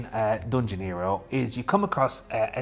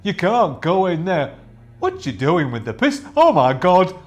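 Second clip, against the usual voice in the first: higher, louder gargoyle, ending in alarm; 6 semitones higher, 7 dB louder than usual. Acted. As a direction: gargoyle spots the pistol and panics. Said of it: "So it goes".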